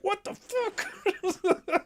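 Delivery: high, slow, laughing what the fuck; laughing disbelief. Retold or imitated. Streamer retelling his reaction, laughing high and slow. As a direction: stretch it out while cracking up. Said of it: "I was like".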